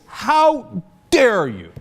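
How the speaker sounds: slow sarcastic how dare you; mock outrage. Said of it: "the Israelis and the U S says".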